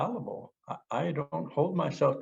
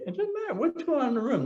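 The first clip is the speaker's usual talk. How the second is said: high fast rush; much faster than usual. Real, not acted.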